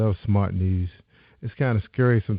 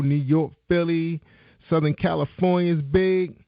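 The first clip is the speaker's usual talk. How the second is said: upbeat host voice, pitched up, calling out the cities; radio host break call; 5 semitones higher than usual. Acted. Radio host announcing a break to listeners, upbeat call.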